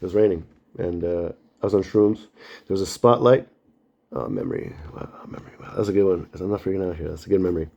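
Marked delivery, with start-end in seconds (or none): 4.1-5.7 whispered aside to himself; awed whisper. Real, not acted. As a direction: drop to a soft, voiceless murmur of wonder. Aside to himself, struck by memory.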